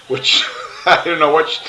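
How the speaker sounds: laughing at his own comeback; amused laugh. Real, not acted. Laughing at his own retort to her. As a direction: chuckle through the words.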